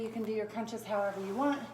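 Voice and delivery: normal talking voice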